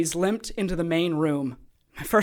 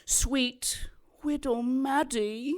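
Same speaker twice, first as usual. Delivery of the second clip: mock sweet; 6 semitones higher, 5 dB quieter, slower than usual. Villain mocking.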